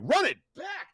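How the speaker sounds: squeaky shocked echo, pitched far up; incredulous. Retold or imitated. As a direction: repeat it in total disbelief. Disbelief at coach's order.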